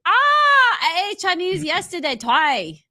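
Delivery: high, loud mock shout. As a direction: yell it out in a hammed-up put-on voice. Shouting joke in put-on voice.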